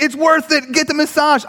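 high-pitched mock endorsement; blissful convert. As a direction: urge it like a delighted customer. Blissful massaged man urges him.

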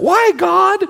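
high, loud plaintive wail; whiny cry to God. Retold or imitated. Pastor mimics a complaining prayer, loud plaintive wail. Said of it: "our prayer starts out".

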